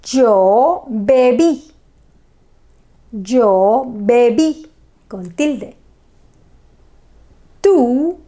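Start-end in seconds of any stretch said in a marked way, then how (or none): 0.1-4.7 slow, drawn-out "Yo bebí"; slow model sentence. Shown as a demonstration. Teacher modelling beber for students, slow. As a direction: say it slowly for repetition. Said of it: "So, beber".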